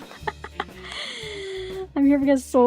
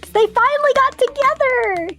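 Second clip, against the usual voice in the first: excited; 10 semitones higher than usual. Real, not acted. Gushing about couple.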